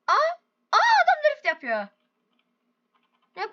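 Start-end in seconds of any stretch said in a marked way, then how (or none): 0.1-1.9 much higher amazed cry; amazed exclamation. Real, not acted. Gamer sees a man drifting, amazed. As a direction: gasp in disbelief.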